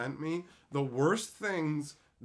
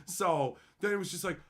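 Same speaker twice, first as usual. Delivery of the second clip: ordinary talk, nothing marked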